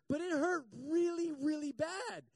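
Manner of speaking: milk the pain for sympathy; dramatic complaining. Retold or imitated. A dramatic man complaining about a wasp sting, whiny.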